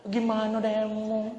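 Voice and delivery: tender Kelantan-style nagging, lightly voiced; soft Kelantan wife. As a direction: scold sweetly like a gentle Kelantanese wife. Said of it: "dia cakap lemah".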